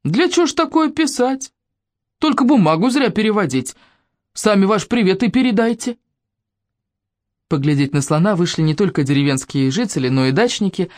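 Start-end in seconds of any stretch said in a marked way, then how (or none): 0.0-6.0 high, frowning disapproval; grumpy grandpa scolding; grumbling old man. Acted. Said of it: "Дед Пашков нахмурился".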